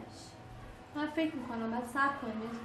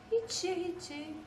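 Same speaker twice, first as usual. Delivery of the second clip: slow, higher questioning repeat; incredulous echo; 5 semitones higher, slower than usual. Real, not acted. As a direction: echo it back slowly in disbelief. Challenges the answer she just got. (با ناباوری و کشیده)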